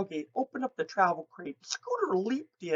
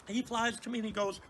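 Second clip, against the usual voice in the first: ordinary talk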